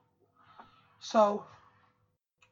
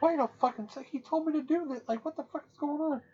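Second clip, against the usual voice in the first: higher-pitched, incredulous complaint; mock indignant protest; 7 semitones higher than usual. Drunk guy protesting that his friend set him up, mock indignant. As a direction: act out baffled indignation at being sold out.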